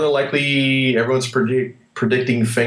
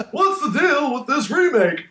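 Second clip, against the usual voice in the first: high, slow, laughing Seinfeld-style question; Jerry Seinfeld impression; 13 semitones higher, slower than usual. Friend mocks the Rock as Seinfeld, high and laughing. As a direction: ham up the Seinfeld observational whine. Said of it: "is he Jerry Seinfeld all of a sudden?".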